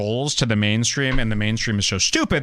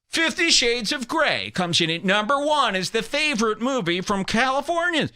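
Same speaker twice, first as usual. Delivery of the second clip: higher, pompous announcer; mock authority; 8 semitones higher than usual. Magazine host unveiling the top movie, in a pompous voice. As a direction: declare the result with fake weight. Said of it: "they say it with authority".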